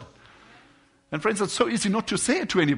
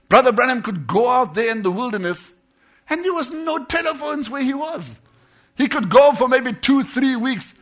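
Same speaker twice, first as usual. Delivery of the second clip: loud, high-pitched preaching burst; full-force preaching; 7 semitones higher, 10 dB louder than usual. Real, not acted. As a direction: drive it home to the congregation. Preacher raises his voice.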